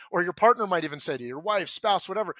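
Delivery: ordinary talk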